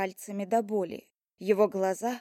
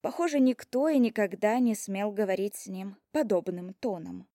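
ordinary delivery

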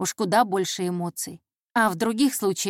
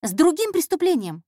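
put-on higher, louder, decisive girl; clear, firm answer; 8 semitones higher, 4 dB louder than usual. Intern finally answering the detective, clear and firm. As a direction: answer crisply at last. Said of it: "наконец-то чётко ответила Пьяти".

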